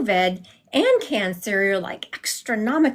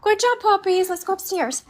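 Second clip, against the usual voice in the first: praising the dogs; 10 semitones higher than usual. Real, not acted.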